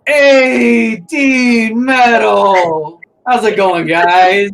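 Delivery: bursting in like an overexcited friend; booming Goff greeting. Acted. GM voices Goff's loud greeting.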